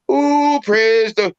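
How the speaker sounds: mock awe. Imitating awestruck crowd at ET.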